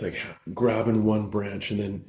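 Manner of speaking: usual speaking voice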